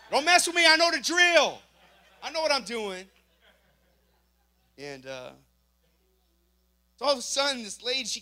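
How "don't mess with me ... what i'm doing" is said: loud, high, cocky boast; cocky shout. Retold or imitated. Joking to the congregation, loud high cocky boast. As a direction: crow that you know the drill, loud and high.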